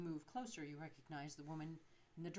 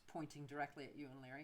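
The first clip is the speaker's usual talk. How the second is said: usual speaking voice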